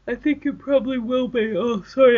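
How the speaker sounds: talking through a yawn; words stretched by a yawn. Real, not acted. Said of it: "I'm yawning now".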